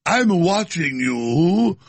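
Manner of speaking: slow, mock-sinister fake British accent; lightly put-on British voice, drawn out. Mocking a sinister official in a fake British accent. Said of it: "with my fake British accent".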